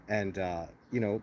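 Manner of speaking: usual speaking voice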